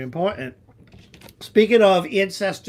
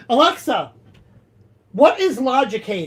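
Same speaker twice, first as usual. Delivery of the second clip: calling out; 5 semitones higher, 7 dB louder than usual. Real, not acted. Calling out to smart speaker.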